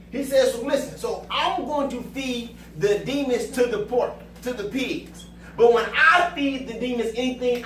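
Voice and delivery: preaching. Real, not acted. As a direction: drive the sermon home. Preaching at full force.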